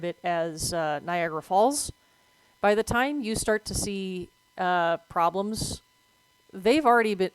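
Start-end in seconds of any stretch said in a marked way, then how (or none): none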